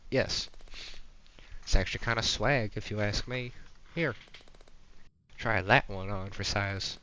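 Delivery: loud Southern skater dude; Southern accent. Acted. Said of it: "This guy's Southern now".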